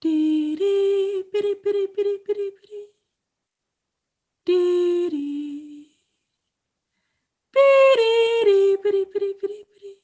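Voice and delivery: high, loud sing-song bird whistle; bird call imitation. Narrator imitating the bird he loves, high sing-song call. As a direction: mimic the bird's trilling call, high and bright. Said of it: "It's going".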